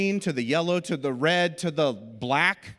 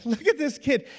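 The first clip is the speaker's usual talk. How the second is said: mocking laugh; 9 semitones higher than usual. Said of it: "they're just like".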